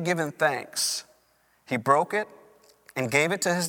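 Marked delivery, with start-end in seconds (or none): none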